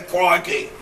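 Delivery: put-on laughing Australian accent, word stretched; mock Australian accent. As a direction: tease her with a hammed-up Aussie boomerang. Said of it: "They say boomerang".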